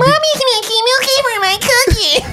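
put-on very high baby talk, laughing; cutesy baby voice. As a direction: coo it absurdly sweet.

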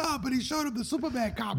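high, whiny mock protest of a friend; imitating Jay. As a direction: squeak out a defensive friend's objection. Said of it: "And Jay was like".

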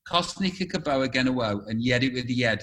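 low, thick Stoke dialect; Stoke accent. Shown as a demonstration. Asked to show off a local saying, Stoke accent. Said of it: "you do a Stoke thing".

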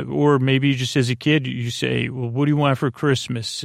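usual speaking voice

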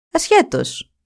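a touch of stern, clipped reply. Acted. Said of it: "απάντησαν αυστηρά οι σύμβουλοι".

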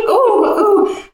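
high, loud mock wail; hammed-up high-pitched ohs; Yvette. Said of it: "I remember Yvette going".

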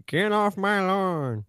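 put-on grumpy old man.

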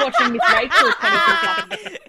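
high and loud, laughing through the words; laughing loudly at the joke. Real, not acted. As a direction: crack up while repeating the line.

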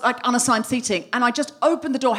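usual speaking voice

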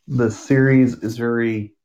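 normal talking voice

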